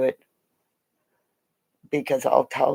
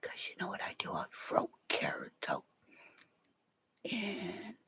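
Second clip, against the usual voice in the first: whisper; 5 semitones higher, 17 dB quieter than usual. Real, not acted. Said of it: "whispered confession to viewers".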